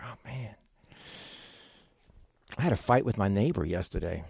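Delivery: low, soft, regretful recollection; sinking realization. Acted. Churchgoer remembering a fight with a neighbor, sinking realization. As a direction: drop to a quiet, low dawning regret.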